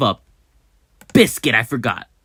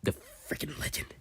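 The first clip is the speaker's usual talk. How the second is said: awed whisper; 15 dB quieter, faster than usual. Real, not acted. Awed aside about ship.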